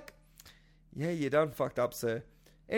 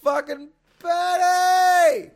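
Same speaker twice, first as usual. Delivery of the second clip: drawn-out, much higher and louder shout of Purdy; hyped shout; 11 semitones higher, 12 dB louder, slower than usual. Real, not acted. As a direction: bellow the name like a fan in the stands. Hyping his pick loudly.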